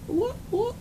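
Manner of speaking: very high playful whoop whoop. Real, not acted. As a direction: sing it up high like a cue. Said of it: "pointing to the cards".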